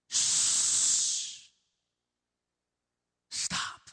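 hushed whisper telling him to stop; whispered shushing. Acted. Crowd silencing Bartimaeus, sharp whispered shush.

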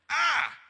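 loud, high pinched "Ah!"; sudden yelp. Pretend. Preacher after telling people to pinch themselves, mock yelp. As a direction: cry out as if pinched.

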